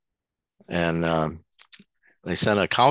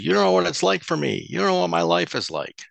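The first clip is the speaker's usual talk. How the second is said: yelling back; 12 semitones higher than usual. Retold or imitated. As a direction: snap back at her, high and hard. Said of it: "I started yelling back at her".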